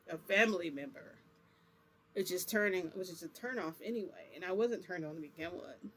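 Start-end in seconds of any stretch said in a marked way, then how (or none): none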